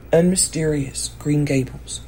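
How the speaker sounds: ordinary delivery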